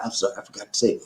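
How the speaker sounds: ordinary talk, nothing marked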